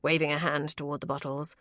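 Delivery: usual speaking voice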